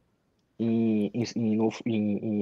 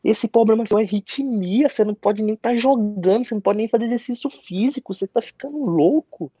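much higher, incredulous mock doctor; doctor telling the player he is crazy, mocking imitation; imitating the doctor; 10 semitones higher than usual. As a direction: play the doctor as if the idea were absurd. Doctor voiced in story.

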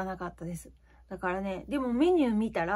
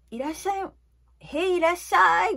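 moderate shop greeting; 9 semitones higher, 6 dB louder than usual. Retold or imitated. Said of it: "ぐらいでいいじゃないですか".

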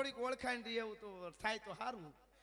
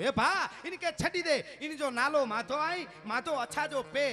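loud, higher commanding yell; outlaw's shout; 7 semitones higher, 10 dB louder, slower than usual. Acted. Outlaw yells at his men to free the boy, loud command. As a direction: bark the order across the square. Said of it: "રાડ નાખી એના માણસોને".

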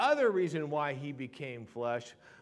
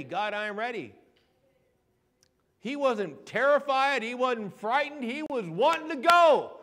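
loud, high-pitched preacher in full flow; fired-up sermon delivery; impassioned preaching; 9 semitones higher, 7 dB louder than usual. Real, not acted. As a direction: preach it with rising fervor to the congregation.

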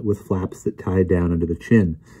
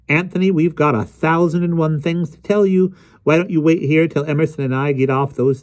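higher-pitched chatty woman character; lightly voiced Miss Eels; 7 semitones higher than usual. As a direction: lightly voice a chatty, self-reproaching friend.

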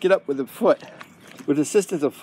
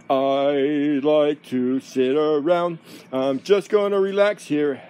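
lazy dog voice; 2 semitones lower, much slower than usual. Pretend. Owner speaks for a resting dog, lazy and slow. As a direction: drag it out like a dog settling down.

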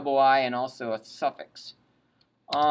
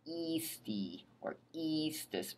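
usual speaking voice

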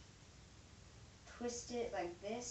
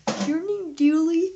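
mock shout; 8 semitones higher, 17 dB louder than usual. Teasing the yo-yo, mock question.

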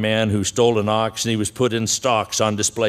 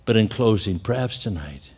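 normal talking voice

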